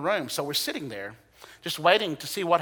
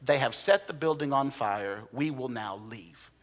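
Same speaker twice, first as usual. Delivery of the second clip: very calm; 4 semitones lower, 6 dB quieter than usual. Retold or imitated. Pastor calmly telling the Americans to leave, quiet and unhurried. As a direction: say it softly, no alarm at all. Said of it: "very calmly says".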